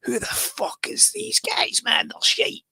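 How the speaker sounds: put-on squeaky, whispered scoff at an old record; a young lad mocking a forgotten band; sneering future bargain hunter. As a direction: sneer it in a high, breathy whisper. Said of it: "he'll dig out one of my records and be like".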